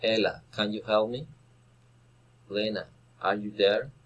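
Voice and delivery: questions to a named person climbing on "me" and "there"; rising direct address. Shown as a demonstration. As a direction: call the name, pause, lift "me" and "there". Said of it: "rising intonation".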